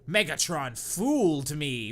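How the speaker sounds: usual speaking voice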